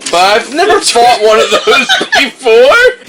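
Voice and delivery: high, loud, drawn-out wail; panicked protest. Acted. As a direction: wail it in hammed-up alarm, half laughing. Player protests fighting a griffin.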